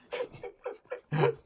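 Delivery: sudden high-pitched laugh; high giggle. Real, not acted. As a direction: giggle at your own wild theory. Laughs at own theory.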